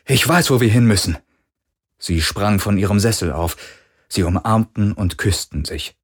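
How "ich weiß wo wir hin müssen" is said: fast, loud, higher-pitched urgency; excited call. A narrator's reading. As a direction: burst in and blurt it out. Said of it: "rief er, als er auf die Brücke stürmte". (hastig und aufgeregt gerufen)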